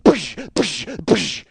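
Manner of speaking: explosive mouth-made impact sounds; three hard bursts of breath; imitated punch noises. Man mimics punching the car roof three times, explosive noises.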